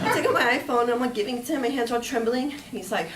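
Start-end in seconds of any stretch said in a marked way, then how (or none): none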